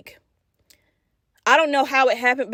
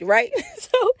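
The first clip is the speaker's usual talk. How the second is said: plain reading voice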